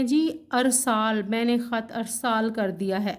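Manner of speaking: "ارسال" with a first, ar-saal; common mispronunciation. Retold or imitated. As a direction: quote everyday speakers saying "ارسال" as arsaal. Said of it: "وہ کہتے ہیں".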